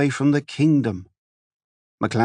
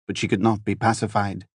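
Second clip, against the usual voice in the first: ordinary delivery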